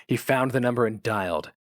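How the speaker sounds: ordinary delivery, nothing marked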